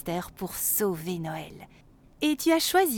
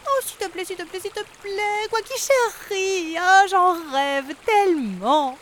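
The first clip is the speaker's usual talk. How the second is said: swan pleading; 9 semitones higher, 6 dB louder than usual. A narrator's reading. Swan Bianca pleading with duck, high wheedling voice. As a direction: beg and beg, pitched way up.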